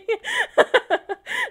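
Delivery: a sudden high squeal of laughter; a squealing giggle at her own joke. Real, not acted. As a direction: burst out in a shrill giggle.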